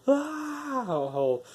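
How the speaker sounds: long high falsetto yell, falling away; mock scream of someone launched skyward; imitated scream. Enemy launched by toilet geyser.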